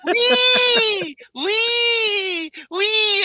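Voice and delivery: high, loud, long-drawn squeals of joy; gleeful whooping. Acted. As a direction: whoop like a kid on a ride. Acts out riding swaying office chair.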